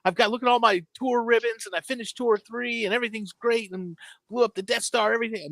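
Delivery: put-on high, eager child voice bursting with news; boy boasting to his mom about game ranks, excited and high; excited kid bragging. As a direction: run to mom thrilled with every promotion. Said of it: "I'd go up".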